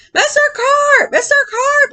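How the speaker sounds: high, slow, frightened cries; panicked calling. Acted. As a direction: call out in fear for Mister Carp. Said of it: "I yelled".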